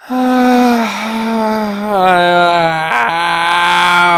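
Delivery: a long, loud groaning sigh; loud exasperated groan. Real, not acted. Torn between two favourites, a loud groaning sigh. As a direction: groan out the frustration of choosing.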